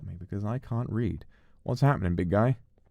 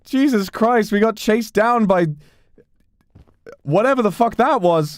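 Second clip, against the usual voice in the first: loud, raised voice, still stunned; shocked exclaiming; 8 semitones higher, 8 dB louder than usual. Real, not acted. Player recapping being chased down, loud shocked exclaiming. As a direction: blurt out the recap in amazement.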